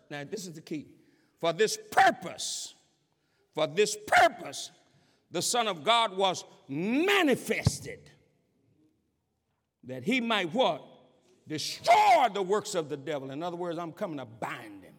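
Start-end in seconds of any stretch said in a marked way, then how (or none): 1.4-13.3 emphatic preaching. Real, not acted. Preacher stressing the key verse to the church, emphatic preaching. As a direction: drive the key verse home.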